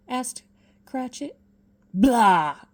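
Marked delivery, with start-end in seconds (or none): 1.9-2.6 loud grumpy bark; gruff outburst. Acted. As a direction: blurt it out crossly. Scrooge's outburst.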